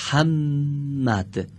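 محمد drawn out very slowly, holding the doubled م; slow gemination demo. Shown as a demonstration. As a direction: stretch محمد, lean on the double m. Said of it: "پس میم دوم دارای تشدیده".

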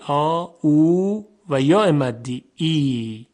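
sustained آ, then long او; long vowels. Shown as a demonstration. Teacher naming the long vowels, held long. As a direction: draw out آ and او as long, open sounds.

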